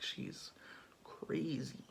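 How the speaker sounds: hushed, breathy aside; whisper. Retold or imitated. Courtiers whispering about Ophelia, hushed whisper. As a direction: whisper it behind her back.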